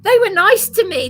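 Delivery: normal talking voice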